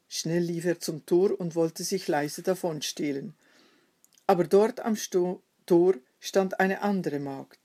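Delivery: ordinary delivery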